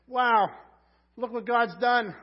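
loud, high cry of wonder; amazed exclamation. Retold or imitated. Preacher voicing a thankful believer, loud amazed cry. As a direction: shout it out in awe. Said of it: "man, you say".

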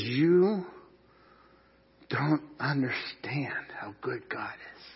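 slow, hushed whisper; a soft, awed secret. Acted. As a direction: draw them in with a whisper. Jesus' words made intimate.

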